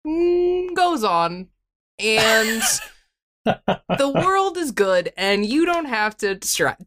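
slow, solemn poem reading; slow poem recital. Pretend. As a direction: recite it slowly like a grand poem. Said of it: "Take us away".

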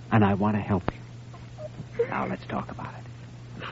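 put-on kindly doctor comforting the tearful girl; gentle reassurance. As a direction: bring it down to almost a whisper. Doctor softens after breakdown.

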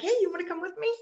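lightly voiced high friendly invite; mild imitation. NFL staffer inviting Debbie along, light high friendly voice. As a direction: sweetly coax her along. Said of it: "She's like".

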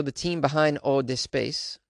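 ordinary delivery

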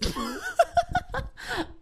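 squealing high laugh. Real, not acted. Vlogger laughing at her own silliness, squealing laugh. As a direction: let out a shrill giggle.

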